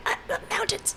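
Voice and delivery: breathy whispered evasive answer; a voiceless, evasive little whisper. Retold or imitated. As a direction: whisper it like you can barely speak. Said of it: "she's Pepper Anning it".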